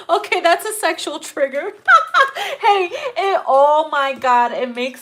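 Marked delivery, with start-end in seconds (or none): 1.8-2.5 very high, loud burst of laughter; real shrieking laughter. Real, not acted. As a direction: squeal with laughter. Cracking up again.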